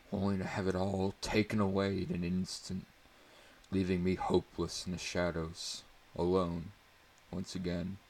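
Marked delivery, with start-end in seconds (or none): none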